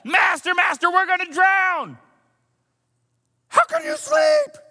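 high, loud panicked cry; frightened disciples shouting at a sleeping Jesus. Retold or imitated. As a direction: scream it like the boat is sinking. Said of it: "come tearing down to where Jesus is like".

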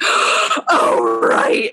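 breathy, overcome mock gasp; gasping delighted recognition. Reader reacting to a beloved character showing up, gasping swoon.

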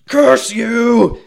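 high, loud, drawn-out monster cry; hammed-up agonised roar, stretched slowly; screamed curse. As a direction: howl it at a hated foe. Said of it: "He screams".

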